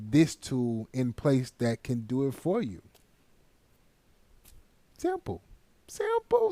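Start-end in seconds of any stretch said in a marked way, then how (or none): 5.0-6.5 high sing-song. Pretend. Playful repeated catchphrase.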